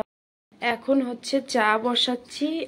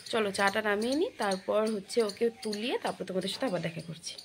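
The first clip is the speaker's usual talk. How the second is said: usual speaking voice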